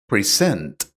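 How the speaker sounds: "present" with weight on the last syllable, pre-SENT; second-syllable stress. Shown as a demonstration. Teacher giving an example of a two-syllable verb, "present" stressed on its second syllable. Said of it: "on the second syllable".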